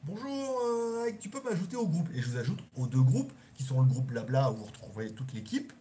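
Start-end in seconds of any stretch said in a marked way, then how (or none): 0.0-2.1 voix bien plus aiguë, polie; fan poli. Retold or imitated. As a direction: imite un abonné qui demande gentiment. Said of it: "en disant". (petite voix polie)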